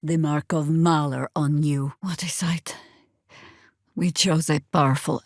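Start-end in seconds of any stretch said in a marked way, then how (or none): 2.0-2.8 soft unvoiced whisper. Companion marvelling quietly at a mark, whispering. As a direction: hush it under your breath.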